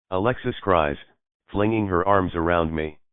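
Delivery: ordinary delivery, nothing marked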